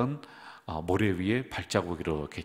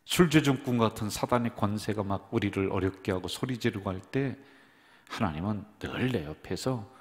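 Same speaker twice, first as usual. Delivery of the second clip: ordinary talk, nothing marked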